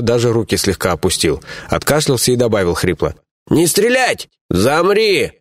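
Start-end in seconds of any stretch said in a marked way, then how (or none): 3.5-5.3 резкий командный окрик; рявкни на пленника; рявкнуть. Acted. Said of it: "рявкнул Сугубо". (рявкая)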